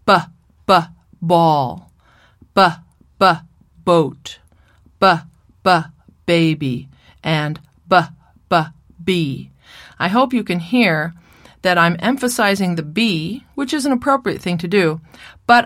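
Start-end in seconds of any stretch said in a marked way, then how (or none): 0.0-9.5 repeated b sounds pushed hard before each word; emphasized B. Shown as a demonstration. A therapist demonstrating the usual consonant-first modelling. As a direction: hit the b hard with a neutral uh vowel. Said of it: "model these words like this".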